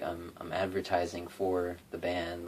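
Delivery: ordinary delivery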